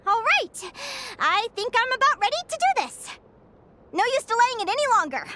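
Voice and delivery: bright fired-up rally; determined. Acted. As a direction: psych yourself up to set off. Psyching herself up to go.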